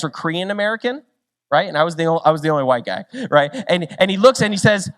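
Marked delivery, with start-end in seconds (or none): none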